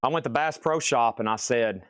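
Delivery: ordinary delivery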